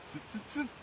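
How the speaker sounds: quietly tsk back like cheeky monkeys; chattering monkeys. A narrator's reading. Monkeys copying the peddler, soft chattering. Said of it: "stamped both their feet back at him and said".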